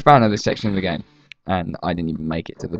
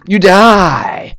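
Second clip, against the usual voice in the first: dramatic; 10 semitones higher, 7 dB louder than usual. Real, not acted. Dramatizing the death.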